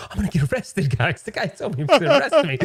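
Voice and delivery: high, laughing imitation of a panicking friend; mock panic. Mason panicking to friends about arrest, laughing mock panic. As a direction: play Mason freaking out, half-laughing. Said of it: "He was panicking so bad".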